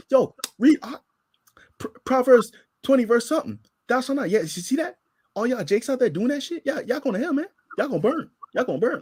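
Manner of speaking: a street preacher yelling at the crowd from the front; mock street preacher. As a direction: condemn the passers-by from the corner.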